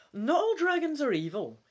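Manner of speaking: hoger en luider, vastberaden; aandringend. Acted. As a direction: zet door, je wilt overtuigen. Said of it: "you insist".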